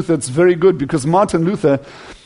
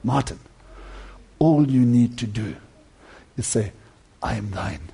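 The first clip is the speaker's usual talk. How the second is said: hushed, much softer and lower counsel; gentle tutor; 8 semitones lower, 11 dB quieter than usual. Acted. Tutor counsels young Martin in his cell, hushed and low. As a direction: lean in and murmur it low and soft. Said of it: "this man said to him".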